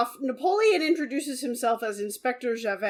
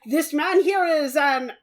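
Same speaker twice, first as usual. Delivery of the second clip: hammed-up fake French accent, louder; mock French accent; 2 semitones higher, 8 dB louder than usual. Mocking the actor's awful French accent. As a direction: ham up a cheesy French inspector. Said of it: "no one in the history of France has ever spoken like that".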